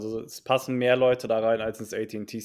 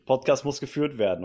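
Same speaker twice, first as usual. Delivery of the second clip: normal talking voice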